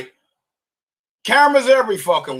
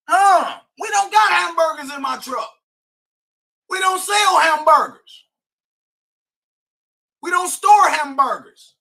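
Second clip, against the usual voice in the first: real angry shouting; 7 semitones higher than usual. Real, not acted. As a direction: yell it like he's defending his property. Furious rant about truck break-in.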